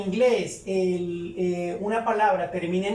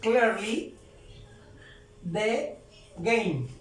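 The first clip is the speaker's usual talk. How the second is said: spaced-out dictation for learners; word-by-word model; much slower than usual. Shown as a demonstration. Teacher reading an English example to learners, word by word. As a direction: say it word by word, pausing between.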